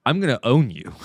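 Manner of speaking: a mild mock tough-guy threat; smug auditor. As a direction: gloat like a self-righteous YouTuber. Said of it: "And he goes".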